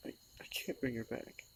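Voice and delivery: soft, choked whisper; a grief-worn whisper; tearful whisper. Real, not acted. Grieving the pet's death.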